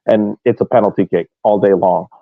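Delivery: usual speaking voice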